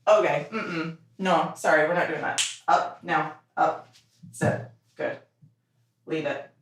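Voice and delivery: ordinary talk, nothing marked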